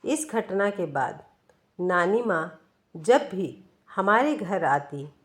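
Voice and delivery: plain reading voice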